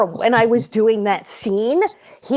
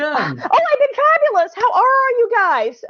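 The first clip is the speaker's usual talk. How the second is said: mock grand; 12 semitones higher than usual. Real, not acted. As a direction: play the diva greeting. Guest answers in grand manner.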